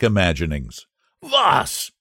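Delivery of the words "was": sharp high German "Was"; Behrman's German outburst, pitched high; German cry. Acted. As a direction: cry out like an outraged old German. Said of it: "he cried".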